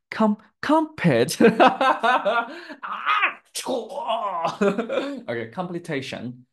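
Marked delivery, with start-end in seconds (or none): 0.1-1.4 halting "com-, compete", drawn out and higher; struggling pronunciation. Real, not acted. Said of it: "hard for me to pronounce". 1.4-5.2 high, loud burst of real laughter; laughing at own mistake. Real, not acted. Laughing at own pronunciation slip.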